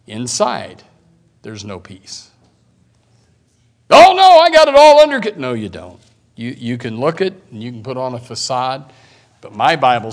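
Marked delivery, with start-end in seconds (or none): 3.9-5.4 very high, loud hammed-up boast; mock bragging. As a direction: squeak out a cocky fake denial. Mocking the wicked's bravado.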